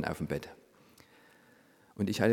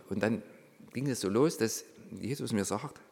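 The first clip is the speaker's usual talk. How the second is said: normal talking voice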